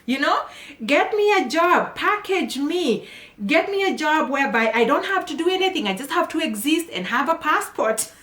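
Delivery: mock pleading client, pitched high; lazy applicant. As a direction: imitate someone expecting everything done for them. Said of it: "they want to do the bare minimum".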